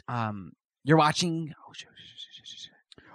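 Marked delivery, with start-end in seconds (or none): 1.7-3.0 breathless whispered oh shit under the breath; whispered cursing. Retold or imitated. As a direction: hiss the curses almost silently. Muttered panic retelling game glitch.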